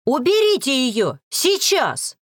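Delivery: high, urgent angry order; strained, furious command; straining yell. A narrator's reading. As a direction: yell it, climbing to the last word. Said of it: "рявкнула, надрывая горло".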